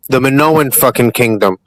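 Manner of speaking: ordinary talk, nothing marked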